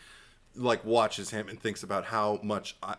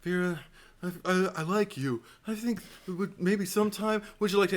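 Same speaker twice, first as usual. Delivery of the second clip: higher, halting, nervous crush; nervous teen asking out; 8 semitones higher than usual. Retold or imitated. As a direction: stammer out the ask nervously. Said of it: "And he's like".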